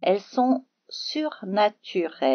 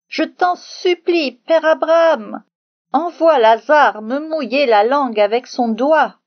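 crying out to Abraham, much louder and higher, begging; cri suppliant; 6 semitones higher, 9 dB louder than usual. Acted. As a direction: shout a desperate plea from the fire. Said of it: "Il crie au secours à Abraham". (crie au secours, beaucoup plus fort et plus aigu, en suppliant)

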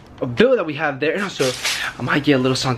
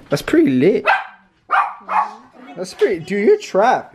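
ordinary talk

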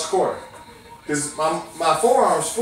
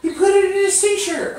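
high-pitched mock whine of a tricked kid; mock crying; 13 semitones higher than usual. Magician voices the sick kid's pretend crying, high mock whine. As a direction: pretend to cry about the trick, hammed-up. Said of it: "I want you to cry and say".

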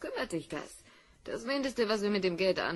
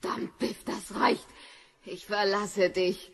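louder, forceful, slowing on the last words; angry outburst; 7 dB louder than usual. Acted. Wife telling Biff she is leaving him, angry. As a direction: snap at him, then declare it slowly.

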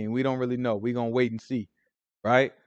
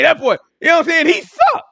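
high, fast joking "He suck"; mock trash talk; 13 semitones higher, 6 dB louder than usual. Preacher jokingly trash-talks LeBron, high and fast. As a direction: tease LeBron in a squeaky voice.

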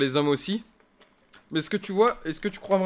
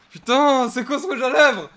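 angry outburst; 7 semitones higher, 9 dB louder than usual. Real, not acted. Lipstick hurts, outburst.

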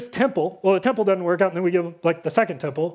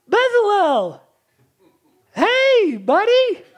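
high, bright call of hey buddy; chummy greeting; 8 semitones higher, 4 dB louder, slower than usual. Pretend. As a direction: greet him like an old pal. Casual greeting to Bezalel.

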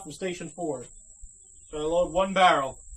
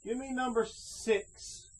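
ordinary delivery

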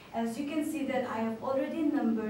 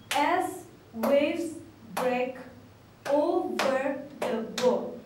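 louder, each syllable hit on a clap; syllables clapped out; 2 semitones higher, 5 dB louder, much slower than usual. Shown as a demonstration. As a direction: beat out every syllable of the line. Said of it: "emphasizing on the number of syllables by clapping".

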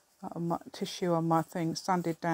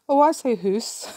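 imitated Scottish "hoose", pitched up and louder; Scottish accent; 5 semitones higher, 8 dB louder than usual. Crafter retelling Wendy's reply on Zoom, imitated Scottish accent. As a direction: quote a Scottish lady, loud and higher. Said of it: "that Scottish lady".

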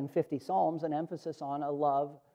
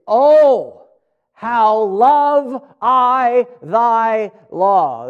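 loud, higher, very slow on every word; emphatic exclamation; 4 semitones higher, 10 dB louder, much slower than usual. Acted. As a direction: proclaim "Oh" and "love", drawn out and forceful. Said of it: "gives us an emphasis".

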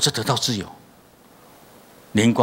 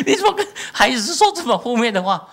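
higher, louder, laughing through it; laughing rebuke; 13 semitones higher, 5 dB louder than usual. Retold or imitated. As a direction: scold while laughing, pitched up.

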